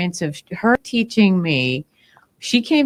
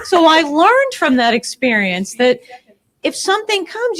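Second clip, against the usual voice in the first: ordinary talk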